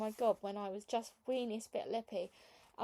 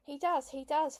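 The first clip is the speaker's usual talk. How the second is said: yelled; 5 semitones higher, 5 dB louder than usual. A narrator's reading. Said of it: "Football yelled".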